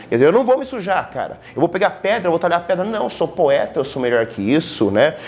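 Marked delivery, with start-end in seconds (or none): 0.1-3.8 higher, fast, prissy voice of a snobbish poet; mock haughty poet. Teacher mocking the Parnassian poet to students, higher and quick. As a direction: play the poet too refined to get his hands dirty.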